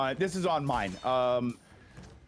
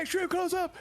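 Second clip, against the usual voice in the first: mock announcer; 12 semitones higher than usual. Recalling the Wayne's World camera bit, announcer style. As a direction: echo the show's dramatic callout. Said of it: "They would do extreme".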